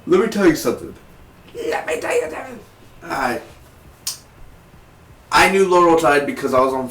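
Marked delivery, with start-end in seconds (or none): 0.1-0.9 high-pitched opening, falling away; raised voice. Pretend. Reviewer about to vent over Laurel's death, raised voice. Same words repeated, far higher second. 1.5-2.6 extremely high, squeaky put-on voice; mock squeak. Reviewer about to vent over Laurel's death, tiny squeak. Same words repeated in squeak.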